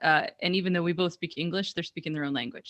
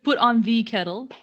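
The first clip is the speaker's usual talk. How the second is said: "put on the kettle" said louder, with "the" fully sounded; article spelled out; 5 semitones higher, 6 dB louder than usual. Shown as a demonstration.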